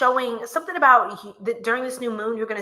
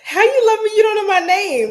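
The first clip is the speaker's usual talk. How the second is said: high, loud laughing tease; giggling mock outrage at a viewer; 9 semitones higher, 8 dB louder than usual. Real, not acted. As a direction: crack up while calling them out. Teasing viewer who loves her.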